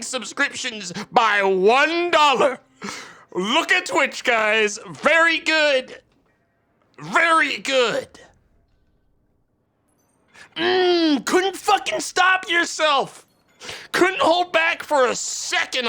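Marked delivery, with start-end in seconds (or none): 3.3-8.1 high-pitched, drawn-out mock applause; sarcastic praise. Streamer mocking Twitch's generous discount, sarcastic praise. 10.6-15.9 high, stretched-out mock admiration; mocking sarcasm. Streamer mocking Twitch's tiny discount, sarcastic taunt.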